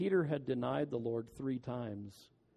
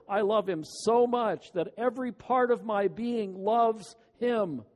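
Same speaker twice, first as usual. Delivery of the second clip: loud, higher, every "all" hammered; ringing pulpit declaration; forceful preaching; 6 semitones higher, 8 dB louder than usual. Acted. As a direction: proclaim the commandment like a sermon peak. Preacher quotes commandment forcefully.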